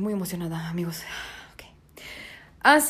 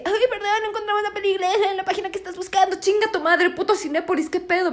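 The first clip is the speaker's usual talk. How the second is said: much higher, fast, put-on cartoon voice; mock Alfred Hitchcock; 9 semitones higher, faster than usual. Mocking the site's error page, squeaky and fast. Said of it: "salía Alfred Hitchcock y decía".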